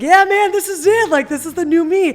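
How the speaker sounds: loud, high, elated announcement; reliving a giddy triumph. Retold or imitated. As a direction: crow it proudly to your buddy. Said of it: "I was, like, on a high".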